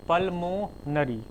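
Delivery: Pulmonary stretched out slowly, syllable by syllable; slow dictation. Shown as a demonstration. Teacher dictating the word to students, slow and drawn out.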